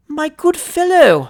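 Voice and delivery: slow and high, shivering with cold; teeth chattering. A narrator's reading. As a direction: stammer it out freezing in a nightshirt. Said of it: "his teeth chattering all the time he spoke".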